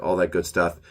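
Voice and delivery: ordinary talk, nothing marked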